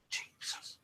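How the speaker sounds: "Jesus" breathed in a faint whisper; very quiet whispered "Jesus" copying the EVP; whispered imitation. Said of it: "Very quietly".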